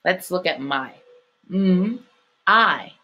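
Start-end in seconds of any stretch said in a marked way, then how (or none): 1.5-2.9 MM held, then a separate I; slow phonics split, MM, pause, I; sounding out. Shown as a demonstration. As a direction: break the word into MM and long I. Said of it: "what sound does the Y make".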